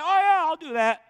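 high, loud goofy voice mocking a fool; dim-witted agreement. Jehoshaphat foolishly agreeing to wear the robes, high goofy mock voice.

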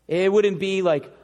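ordinary delivery

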